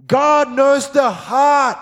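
drawn out, every word weighted; slow emphatic proclamation. Real, not acted. Preacher to congregation, slow and loud. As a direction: preach it slowly, full voice. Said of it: "preaching, slow and loud".

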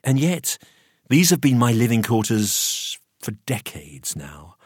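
usual speaking voice

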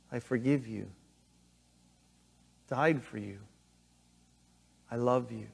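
soft, low, tender reassurance; gently forgive, pausing after each phrase; tender forgiveness. Acted. Voicing Jesus forgiving the failed.

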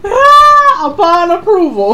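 high, loud laughing repeat; excited. Real, not acted. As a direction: shout it with glee. Repeats louder, higher.

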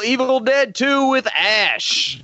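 slow, high hype announcement of the name; ring announcer voice. Acted. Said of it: "Bruce Buffer voice".

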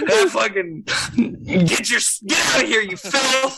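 mic clipping and distorting; clipping distorted mic. Real, not acted. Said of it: "your mic is clipping so much".

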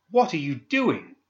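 high, slow, furious demand; angry demand. Acted. Pandora catches Candy torturing a man, angry demand. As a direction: demand an answer, outraged. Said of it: "she commanded angrily".

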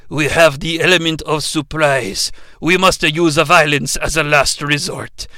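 measured warrior with a put-on Japanese accent; Ken Watanabe. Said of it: "Ken Watanabe? He's like".

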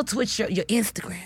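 unvoiced, breathy aside; whispered aside. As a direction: slip it in under the breath.

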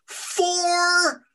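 very high, drawn-out goblin shriek. A narrator's reading. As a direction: shriek the word out and hold it high. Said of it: "Spittle occasionally shrieking the word".

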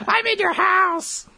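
high, drawn-out put-on voice; mock-creepy high singsong; Katori. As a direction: squeal it slowly as a silly pretend guest. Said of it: "Why do you sound like Katori?".

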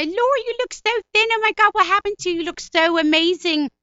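shrieky, gushing Philly woman, very loud; very high-pitched friend. Retold or imitated. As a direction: squeal compliments way up high. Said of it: "go as high, as her voice goes".

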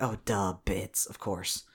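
quiet, low "oh, duh, bits"; soft, low self-mocking realization; dawning realization. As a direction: mutter it as the penny drops. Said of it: "and I was like".

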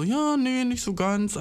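high, hesitant voice of the woman; imitating her. She politely dodges his question, high and hesitant. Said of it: "sie war dann so".

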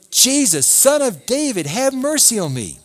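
loud, high cry for mercy. A narrator's reading. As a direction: call out to him, pleading loudly. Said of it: "he began to cry out".

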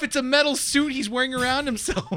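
high, loud, worked-up objection; loud comic exasperation; incredulous protest. Real, not acted. Mock-outraged objection to buff Doom.

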